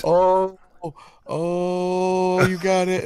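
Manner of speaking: high mock-excited squeals of oh; imitating excited passenger. Friend mimics thrilled passenger in skidding car, high squeals. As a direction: mimic the girl squealing while the car skids. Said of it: "She's like".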